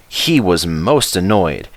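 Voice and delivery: plain reading voice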